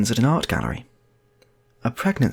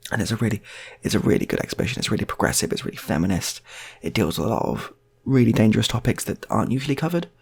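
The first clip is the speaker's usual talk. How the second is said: normal talking voice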